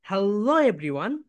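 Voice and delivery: very slow "Hello", the "-lo" rising and dipping; stretched stress on Hello. Shown as a demonstration. Teacher leads students in an intro, stretched stress on "Hello". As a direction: draw out "Hello" and lift the end of "everyone". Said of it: "এখানে তোমরা stress দিবে".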